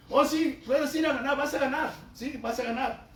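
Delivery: high, loud sing-song yes-man reassurance; sarcastic prophet echoing what the king wants; mock flattery. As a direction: parrot the flatterers with a mocking lilt. Prophet mimics the false prophets' flattery.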